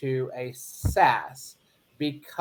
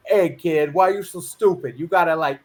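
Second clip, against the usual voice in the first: put-on a light take on an old movie mobster, a bit louder; mafia tough guy; 3 semitones higher, 4 dB louder than usual. Imitating a mafia movie boss scolding a kid, lightly voiced. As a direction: ham up a gangster scolding the kid. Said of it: "those old, like, mafia movies".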